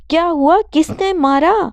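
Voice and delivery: high, fussing mother's question; doting concern. Acted. Mother doting over her beaten son, high and fussing. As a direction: coo over the hurt child anxiously.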